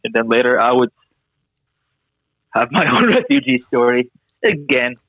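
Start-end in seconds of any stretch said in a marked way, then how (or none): none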